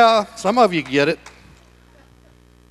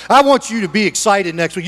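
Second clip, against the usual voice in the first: usual speaking voice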